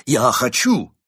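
loud eager cry; exclaiming. Acted. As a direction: blurt it out eagerly. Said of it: "воскликнул Крот".

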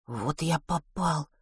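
despairing murmur. Acted. Matvey's despairing thought.

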